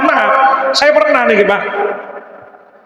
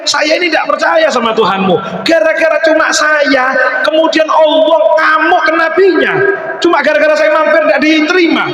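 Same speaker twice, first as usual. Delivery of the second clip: astonished outcry; 7 semitones higher than usual. Acted. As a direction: marvel that God defends him. Majusi astonished at God's anger.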